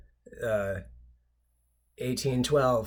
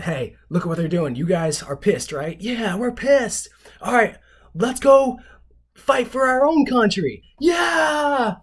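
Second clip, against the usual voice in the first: starts raised, climbs much higher and louder to a yelled yeah; rabble-rousing build to a shout; 11 semitones higher, 8 dB louder than usual. Retold or imitated. Washington rousing angry colonists to fight: building mock shout. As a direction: whip the crowd up until you're shouting. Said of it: "and then they said".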